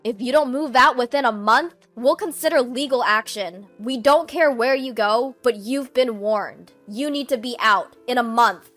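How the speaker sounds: loud, forceful warning; stern ultimatum. Acted. Eden warns his sister of legal action, loud and stern. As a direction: hammer home the deadline.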